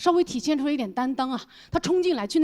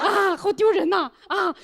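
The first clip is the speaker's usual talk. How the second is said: higher, drawn-out lament; mortified groan; 7 semitones higher, slower than usual.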